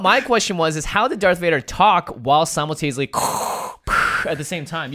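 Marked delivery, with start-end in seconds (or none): none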